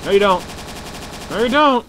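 shouting. Real, not acted. Shouting at enemy.

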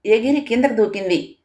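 ordinary delivery

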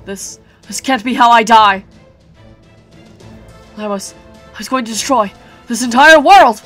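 furious disbelief. Acted. Dying giant's rage.

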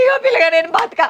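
high tearful shout; despairing shout. Acted. Daughter-in-law shouts in despair at her son. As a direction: cry out that she gives up.

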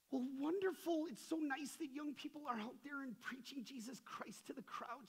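high sweet grandmother voice; sweet old lady. Retold or imitated. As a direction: gush like a kindly grandmother. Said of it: "And she goes".

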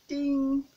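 loud chiming ding; loud bell ding. Shown as a demonstration. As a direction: ring the bell hard. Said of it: "by saying ding".

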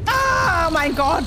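high, loud startled scream; scared scream. Real, not acted. Jump scare in game.

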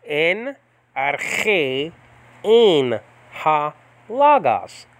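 stretched out, syllable by syllable; careful teacher's recitation; slow biblical Greek. Shown as a demonstration. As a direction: Enarche en halagas, very slow and deliberate. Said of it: "biblical pronunciation first".

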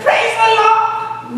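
joyful praise. Retold or imitated. Preacher acting the praise he should have given, rising and drawn out. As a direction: sing out "Praise the Lord!" in slow, high thanks. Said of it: "No, I wasn't able to say".

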